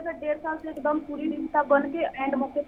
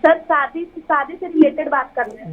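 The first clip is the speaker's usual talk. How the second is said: louder, clearer repeat; speaking up; 6 dB louder than usual. Real, not acted. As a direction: raise your voice to be heard. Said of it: "थोड़ा सा आप ऊंचा बोलेंगे".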